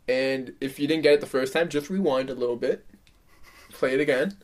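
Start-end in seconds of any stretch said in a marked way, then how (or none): none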